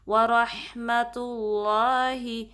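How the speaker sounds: slow, drawn-out Quranic recitation. Shown as a demonstration. Teacher reciting the phrase for students to copy. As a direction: chant it slowly with a heavy lam on اللَّهِ. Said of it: "اس کو heavy پڑھتے ہیں".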